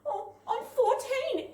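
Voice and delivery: put-on high, slow little-girl voice with a laugh; timid child. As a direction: answer meekly like a scared kid. Frightened child answering.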